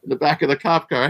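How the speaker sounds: ordinary talk, nothing marked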